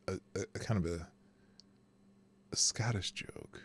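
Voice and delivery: sheepish whisper about the joke; whispered aside. Real, not acted. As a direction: confide it under your breath.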